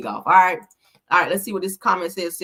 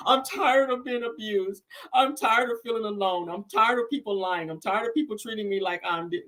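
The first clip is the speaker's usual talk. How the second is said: whiny mock sob voice, mimicking Destiny; hammed-up teary whimper; mock crying; 5 semitones higher, 4 dB quieter than usual. Mocking a reality star crying on her livestream. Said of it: "just start crying".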